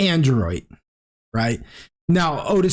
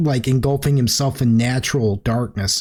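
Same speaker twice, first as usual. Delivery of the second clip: normal talking voice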